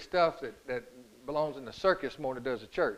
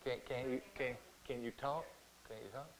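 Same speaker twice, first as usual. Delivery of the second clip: gentle soft question; 4 semitones lower, 15 dB quieter than usual. Retold or imitated. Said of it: "He's like that".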